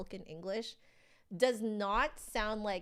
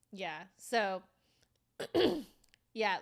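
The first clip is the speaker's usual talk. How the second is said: normal talking voice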